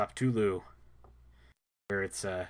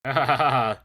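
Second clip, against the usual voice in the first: loud booming villain laugh; barbarian laugh; 2 semitones higher, 11 dB louder than usual. Pretend. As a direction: laugh like a gloating warlord. Villain laugh while playing.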